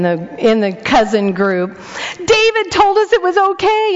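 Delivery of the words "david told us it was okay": put-on high, whiny kid voice; tattling kids. Stung kids telling grandma who to blame, high whiny kid voice. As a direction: pass the blame like a child.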